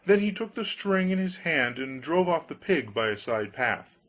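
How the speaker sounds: ordinary delivery, nothing marked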